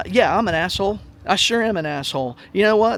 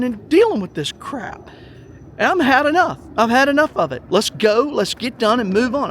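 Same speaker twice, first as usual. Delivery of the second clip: raised, louder and higher, fed up; angry outburst; 5 semitones higher, 4 dB louder than usual. Retold or imitated. Retelling how he told the board off, angry and loud. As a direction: let them have it, you've had enough.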